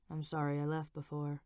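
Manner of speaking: low, soft husband voice; husband instructing the nurse, low and soft; Frank's voice. A narrator's reading. Frank speaks to unconscious wife.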